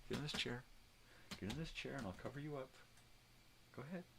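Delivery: usual speaking voice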